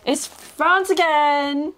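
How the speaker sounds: high, long drawn-out exclamation; excited reveal. Real, not acted. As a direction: stretch each word in a big reveal. Announcing a repeated team.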